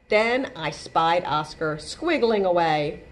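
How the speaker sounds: usual speaking voice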